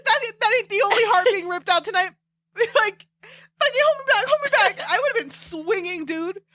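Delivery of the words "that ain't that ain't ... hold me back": high, laughing mock threat; hammed-up hold-me-back rant; mock fight-ready rant. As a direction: pretend brawler laughing high. Fan pretending to fight Vane.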